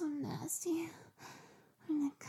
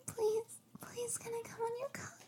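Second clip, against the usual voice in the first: high whispered begging; 7 semitones higher than usual. Acted. Begging to come.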